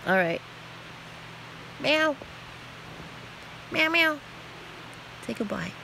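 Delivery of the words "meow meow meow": high, light meow for the cat; mock meow. Owner meowing for her cat's goodbye, high mock meow. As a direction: voice the cat saying bye.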